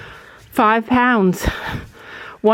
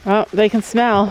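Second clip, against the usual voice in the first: usual speaking voice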